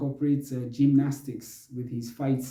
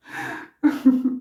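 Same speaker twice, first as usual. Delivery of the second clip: high airy giggle; 12 semitones higher, 3 dB louder than usual. Real, not acted. Laughs at peeing joke.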